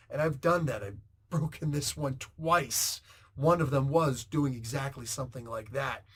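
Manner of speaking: usual speaking voice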